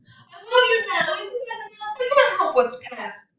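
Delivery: high, tearful pleading with a cry in the voice; sobbing. A narrator's reading. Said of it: "She began to sob".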